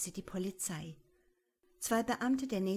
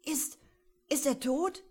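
frightened stammer; 7 semitones higher, 5 dB louder than usual. A narrator's reading. Frightened boy at a body.

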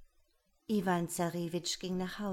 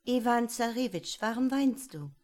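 higher, caring frog voice; the frog bride asking; 5 semitones higher, 5 dB louder than usual. Acted. Frog asking her weeping husband, lightly voiced. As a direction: ask gently, a bit raised. Der Frosch aber kroch ihm entgegen und fragte.

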